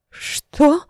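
high, stunned «Что?»; shocked gasp. Acted. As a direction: blurt it out in shock. Shocked reaction to accusation.

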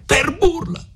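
very high, heated retort; indignant cry. A narrator's reading. Renzo confronts seated Agnese face to face, indignant cry. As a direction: throw it back high, then threaten. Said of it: "Gridò Renzo".

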